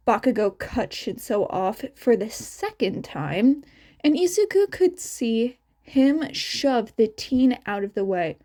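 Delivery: plain reading voice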